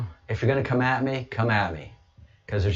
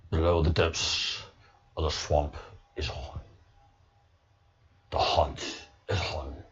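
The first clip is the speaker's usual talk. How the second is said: Red October voice; 6 semitones lower, 6 dB quieter than usual. Pretend. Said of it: "a Red October voice".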